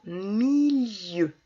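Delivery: "milieu" very slowly, long i gliding into eu; drawn-out syllable. Shown as a demonstration. Teacher giving an example word for ieu, drawn out. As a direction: stretch out the middle of "milieu". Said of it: "Comme dans".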